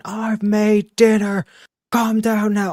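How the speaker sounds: high, drawn-out mock scream of a woman; slow, high-pitched retold yell; imitated screaming. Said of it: "you just hear some screaming from a woman".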